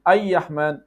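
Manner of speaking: 'Ay-Yahman' with a Y glide for the R; R turned to Y. Shown as a demonstration. Teacher recapping mistakes, Y replacing R. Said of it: "that is like".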